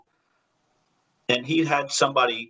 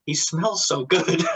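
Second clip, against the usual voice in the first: swooning; 7 semitones higher, slower than usual. Retold or imitated. Wife swooning over actor, laughing.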